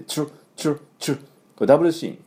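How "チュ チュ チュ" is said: チュ as a breathy "ch"-like tr cluster with no voice; チュ, a whispered tr burst repeated; tr sound drill. Shown as a demonstration. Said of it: "こういう音になります". (「チュ」をささやくように)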